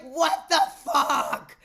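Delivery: very slow, loud, high outburst; aghast shout. Retold or imitated. Patient reacting to a terrifying trip, aghast shout. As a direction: bellow it, stretching every word. Acting out freak-out on the freeway.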